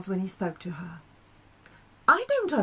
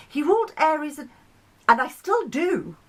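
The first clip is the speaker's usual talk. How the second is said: higher, louder, fast cut-in; eager interruption; 7 semitones higher, 5 dB louder than usual. Acted. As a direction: jump in before he finishes. Mars interrupts Pluto.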